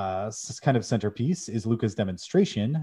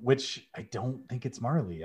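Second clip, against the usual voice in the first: ordinary talk, nothing marked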